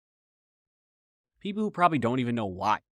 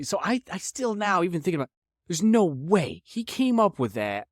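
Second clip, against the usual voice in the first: normal talking voice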